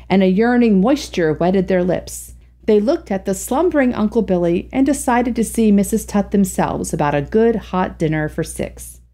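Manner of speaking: usual speaking voice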